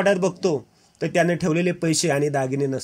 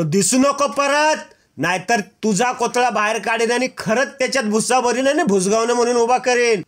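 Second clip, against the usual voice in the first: furious threat; 7 semitones higher than usual. Acted. Mahipat threatening the guard as he runs away, furious. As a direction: drive him off with a savage threat.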